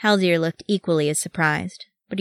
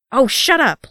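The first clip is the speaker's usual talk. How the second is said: higher, louder angry snap; angrily; 8 semitones higher, 6 dB louder than usual. A narrator's reading. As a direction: cut him off in anger. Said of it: "Loni told him angrily".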